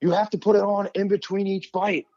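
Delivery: mocking the agent. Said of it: "he goes".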